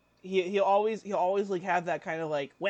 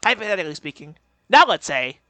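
loud imitated debater interjection; punched-out mock hypothetical; Ben Shapiro; 12 dB louder, faster than usual. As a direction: launch in like a nasally pundit. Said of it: "So he'll be like".

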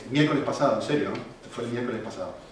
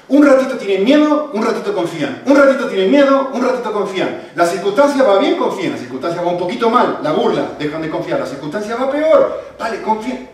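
full-force preaching; 8 semitones higher, 13 dB louder than usual. Acted. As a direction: preach it out loud to the whole church. Preaching to the congregation.

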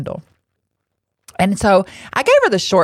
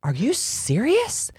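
higher, softer, slow disbelief; slow stunned question; mock shocked stranger; incredulous; 6 semitones higher, 6 dB quieter, slower than usual. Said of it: "she looks back at me and she says".

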